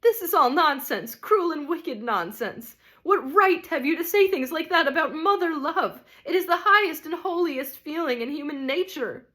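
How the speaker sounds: a mother offended to the core; hammed-up indignant ghost with a scoffing laugh. A narrator's reading. Ghost angrily rejects the spirit.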